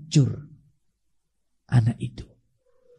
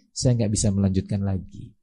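student's quiet words; 9 semitones lower, 8 dB quieter, faster than usual. Retold or imitated. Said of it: "dia mengatakan".

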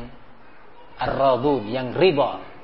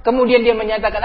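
usual speaking voice

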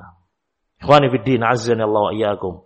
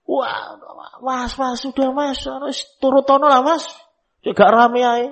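high pleading wife voice, lightly put on; 12 semitones higher than usual. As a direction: beg the husband in a higher voice to give in. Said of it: "ibu negara ini". (istri memohon pada suami dengan suara tinggi dibuat-buat)

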